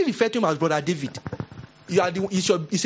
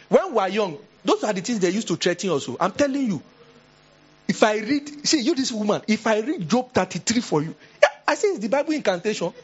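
high excited retelling; excited storytelling; 7 semitones higher than usual. Retold or imitated. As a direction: voice jumps high and eager. Telling children's scare story excitedly.

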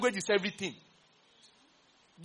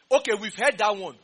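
usual speaking voice

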